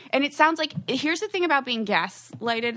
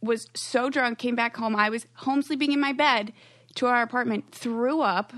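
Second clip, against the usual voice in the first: usual speaking voice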